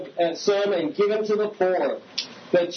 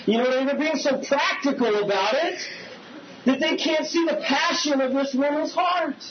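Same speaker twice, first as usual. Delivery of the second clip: preaching shout; 6 semitones higher than usual. Real, not acted. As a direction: drive the point home with a building shout.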